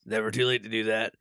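low, Beetlejuice-like put-on voice; Phineas. Podcast host imitating how a character sounds, low put-on voice. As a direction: drop right down into a Beetlejuice-style voice. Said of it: "his voice is like".

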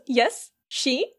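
very slow, each syllable set apart; melodramatic. Retold or imitated. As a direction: tease it out word by word, hammed-up. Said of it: "at her most melodramatic".

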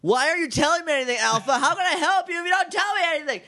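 high, fast, exasperated demanding; mock angry Zordon. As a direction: pepper him with questions, fast and high. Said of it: "angry tone".

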